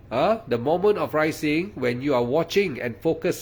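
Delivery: ordinary talk, nothing marked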